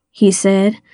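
plain reading voice

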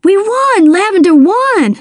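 high, swooping cheer of victory; jubilant, pitch leaping up; excited yelp. A narrator's reading. Dale celebrating Lavender's win, high excited yelping. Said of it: "Dale yelped".

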